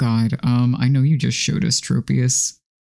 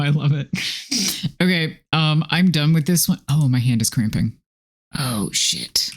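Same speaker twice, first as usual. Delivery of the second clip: ordinary talk, nothing marked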